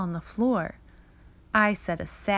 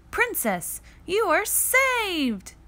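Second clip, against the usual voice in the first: high, slow triumphant hero; hero's rescue line; 11 semitones higher, slower than usual. Acted. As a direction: announce the rescue proudly. Cat boy rescues princess.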